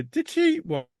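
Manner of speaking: ordinary delivery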